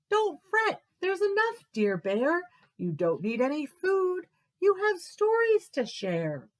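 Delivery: high, squeaky comforting Mouse. Acted.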